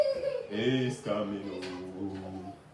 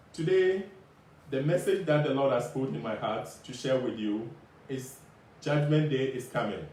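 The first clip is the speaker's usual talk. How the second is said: doomsday street preacher. Acted. Preaching the judgment message.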